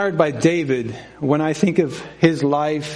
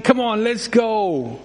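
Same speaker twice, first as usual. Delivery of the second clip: put-on slow, high whiny kid's plea; mock whine of a bored kid begging to leave; whining child; 6 semitones higher, slower than usual. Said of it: "they start whining".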